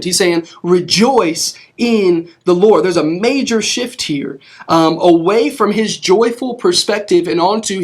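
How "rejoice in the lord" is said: Rejoice in the Lord spoken slowly as an order; slow command. Retold or imitated. Preacher quoting Paul's command slowly. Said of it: "as a command".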